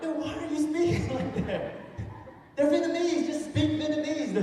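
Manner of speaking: high, loud mock exasperation; exasperated. Comic scolding his friend at sandwich shop, exasperated. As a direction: protest in disbelief, pitched right up.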